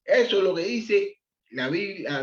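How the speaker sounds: normal talking voice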